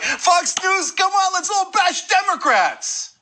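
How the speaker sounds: high mocking Max Rose voice. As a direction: sneer it like a suck-up on Fox. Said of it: "so they send this Max Rose character out there, be like".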